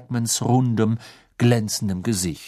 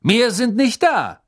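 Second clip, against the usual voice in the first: high, louder, slow call; calling after; 11 semitones higher, 5 dB louder than usual. Acted. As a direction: slowly call out there are no more. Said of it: "rief ihm Mister Diggory nach".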